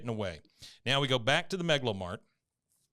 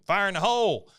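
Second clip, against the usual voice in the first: high, loud warning call; retold military shout; 8 semitones higher, 6 dB louder than usual. Soldier calls out before the tank shot, high shout.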